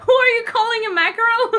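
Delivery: fast, high, loud comic indignation; acted offended yell on the edge of laughter; mock crazed outburst. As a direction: fire it off as hammed-up outrage. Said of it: "Aizuki goes crazy".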